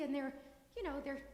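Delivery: ordinary delivery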